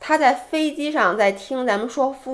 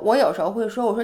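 ordinary delivery

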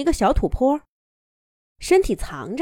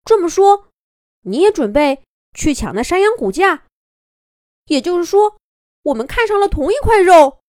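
high, loud and eager; bursting with glee at the coincidence; young wolf thrilled to find a rival for the same meat, loud and high; excited; 7 semitones higher, 6 dB louder than usual. Acted. Excited wolf voices shared target.

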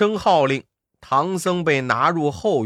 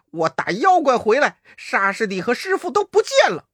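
焦急; 6 semitones higher than usual. Acted. 悟空急找师傅.

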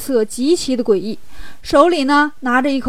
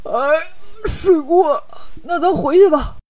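groggy Bajie stretching as he wakes; acted just-woken groan into talk; stretching awake; 3 semitones higher than usual. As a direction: groan through a waking stretch, a little higher. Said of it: "八戒伸了个懒腰".

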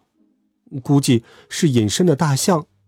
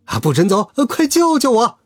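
acted yell, climbing sharply in pitch; desperate shouting; 7 semitones higher than usual. A narrator's reading. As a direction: order turning into a high plea. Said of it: "小胡子大喊".

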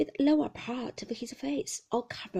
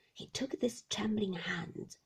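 ordinary delivery, nothing marked

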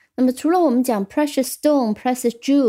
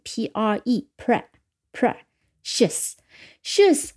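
spelling it out; much slower than usual. Shown as a demonstration. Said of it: "稍微需要记忆一下".

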